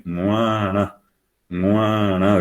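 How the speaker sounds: guara with a nasal G, sounding like ngwana. Shown as a demonstration. Teacher showing students the nasal G in guara, nasalised. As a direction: say guara through the nose, vowels drawn out. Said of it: "Es la G, es nasal".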